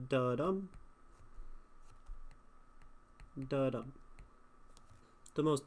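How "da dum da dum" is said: "da-dum" with weak first and strong second beat; iambic stress. Shown as a demonstration. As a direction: say "da-dum" as a single heartbeat, then pause.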